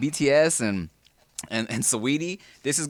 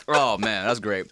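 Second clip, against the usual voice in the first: ordinary talk, nothing marked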